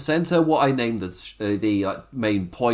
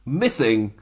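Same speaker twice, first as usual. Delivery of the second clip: ordinary talk, nothing marked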